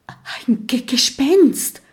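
whispered start breaking into a high stammer. A narrator's reading. Kokosnuss spots a ghost in the corridor, stammering a startled stage whisper. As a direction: gasp it in a whisper, tripping on the word. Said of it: "flüstert Kokosnuss".

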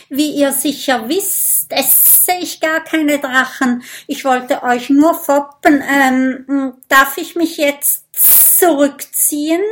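a snake begging to slip away. A narrator's reading. Snake pleads with Mathilda, high and nervous.

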